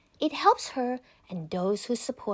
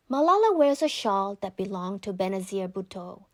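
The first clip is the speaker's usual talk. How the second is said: usual speaking voice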